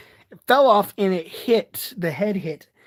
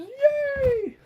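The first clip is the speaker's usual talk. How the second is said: squealed yay. Real, not acted. Mock cheer over new glasses.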